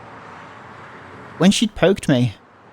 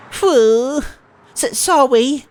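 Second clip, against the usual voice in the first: put-on high, stammering little-girl apology; bashful squeak of sorry; flustered cutesy girl; 9 semitones higher, much slower than usual. Transfer student apologising to Nishizuo-kun, high flustered cute voice.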